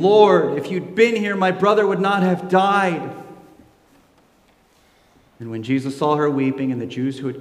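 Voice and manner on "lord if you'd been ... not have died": pleading, higher and louder; grieving plea. Acted. Mary at Jesus' feet pleads in grief. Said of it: "falls at his feet, saying".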